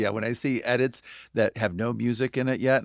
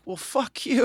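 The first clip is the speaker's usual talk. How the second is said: mock disbelief; 12 semitones higher, 3 dB louder, slower than usual. Retold joking phone greeting.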